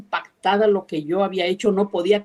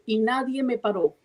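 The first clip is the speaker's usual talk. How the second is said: normal talking voice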